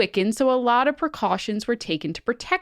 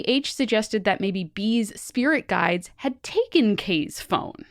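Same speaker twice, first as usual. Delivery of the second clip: usual speaking voice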